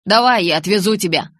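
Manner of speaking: loud, insistent offer. A narrator's reading. Jacob offering injured Bella a ride, insistent. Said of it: "настаивал Джейкоб".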